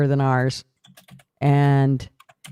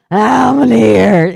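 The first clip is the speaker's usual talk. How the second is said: slower, louder and higher whiny moan; repeated mock whimper; 6 semitones higher, 6 dB louder, slower than usual. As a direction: keep groaning about the knee. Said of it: "while I was going".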